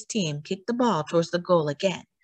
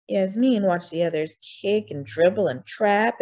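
ordinary delivery

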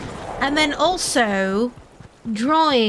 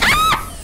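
startled yelp; 4 dB louder than usual. Real, not acted. Shot in game, startled cry.

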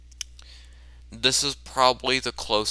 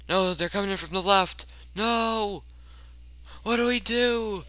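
high, hammed-up alarm; mock panic; 9 semitones higher than usual. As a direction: wail helplessly as the enemy closes in. Acted panic as enemies attack.

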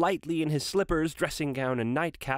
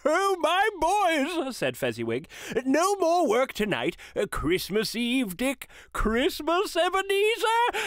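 fat, jovial master, far higher, louder and slow; a jolly boss letting the lads off; 12 semitones higher, 3 dB louder, slower than usual. A narrator's reading. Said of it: "comfortable, oily, rich, fat, jovial voice".

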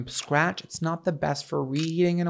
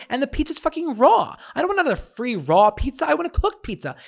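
exasperated rant; 9 semitones higher than usual. Real, not acted. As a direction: vent about it, fed up. Complaining about raw pizza.

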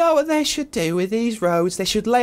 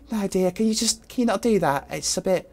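usual speaking voice

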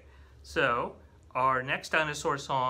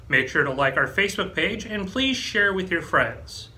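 louder, upbeat promo read; closing announcement; 7 dB louder than usual. Acted. Librarian [name] closing story time with a library plug.

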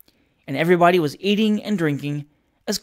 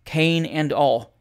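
ordinary delivery

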